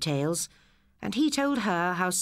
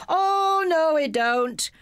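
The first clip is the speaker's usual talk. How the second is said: high, loud, slow panto reply; panto chorus; 13 semitones higher, 6 dB louder, much slower than usual. A narrator's reading. Class choruses back at the pupil, loud panto reply. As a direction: chant it back like a panto crowd. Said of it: "the class chorused".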